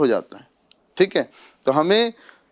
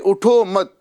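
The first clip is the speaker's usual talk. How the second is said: اٹھو مت joined, high, then halt; اٹھو مت as one higher command; stop on مت; 5 semitones higher, 3 dB louder than usual. Shown as a demonstration. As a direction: run اٹھو into مت and stop dead. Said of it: "مت کے اوپر روکا".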